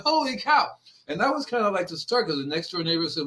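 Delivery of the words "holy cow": much higher, mock-astonished "Holy cow"; amazed exclamation. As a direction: gasp it out like a kid who can't believe his luck. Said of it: "I'm going".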